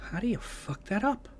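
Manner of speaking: high, odd put-on voice; imitating William Hurt's disbelief; William Hurt quote. Quoting a film villain to mock the series, high put-on voice. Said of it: "In the words of ... William Hurt".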